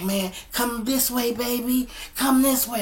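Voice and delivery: high, loud imitation of urgent coaxing; coaxing father. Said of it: "he trying to coach her".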